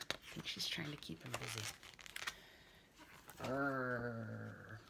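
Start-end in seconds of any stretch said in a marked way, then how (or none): 3.4-4.8 low growl; dog growl. Pretend. Boy pretending to be a scary dog, low growl.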